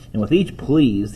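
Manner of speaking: plain reading voice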